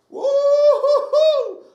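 long, high, loud whooping cry; excited whoop. Real, not acted. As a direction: let out a huge surprised whoop. Sudden jolt of resistance.

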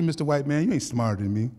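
ordinary talk

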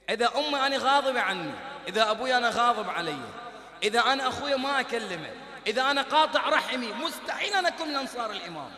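loud, driving sermon cadence; fiery preaching. Acted. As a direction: pound each condition like a verdict. Preacher listing sins, exhorting.

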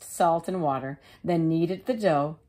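ordinary delivery

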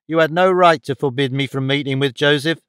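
plain reading voice

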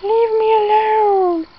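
high indignant bee voice, drawn out at the end. Pretend. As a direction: play a cranky little bee shooing you off. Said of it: "He's like".

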